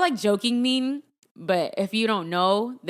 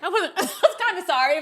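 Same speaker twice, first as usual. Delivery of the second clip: high, laughing admission; 11 semitones higher, 5 dB louder than usual. Real, not acted. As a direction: giggle through it. Laughing confession.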